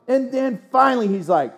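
normal talking voice

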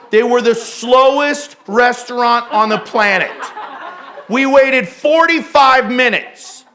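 loud, high exasperated rant; theatrical bad attitude. Pastor confessing his rant about a slow restaurant, theatrical indignation. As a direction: play up the outrage, hitting hard. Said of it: "telling you in theatrical ways what the attitude was".

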